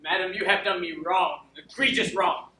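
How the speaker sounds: aggrieved accusation. Acted. Wronged steward confronting his lady, indignant accusation. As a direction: press the grievance hard on each phrase.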